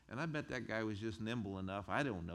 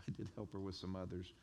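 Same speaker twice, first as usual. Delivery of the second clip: ordinary talk, nothing marked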